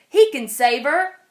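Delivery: loud hopeful call; shout. A narrator's reading. Farmer yelling that the tractor can help, loud call. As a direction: shout it out with hope. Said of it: "the farmer shouted".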